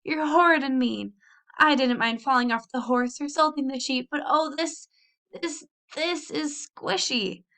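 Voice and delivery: high, teary accusing wail; tearful. A narrator's reading. Annabel sobs at her cousins over the egg. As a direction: cry it out, hurt and upset. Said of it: "Annabel was still sobbing".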